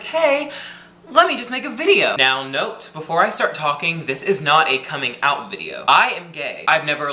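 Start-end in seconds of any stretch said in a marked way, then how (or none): 0.0-2.2 pitched way up, bright and chirpy; mocking his own eager reply to the anon; perky retold quote. Replying to the Tumblr anon, high and eager. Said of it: "so I was like".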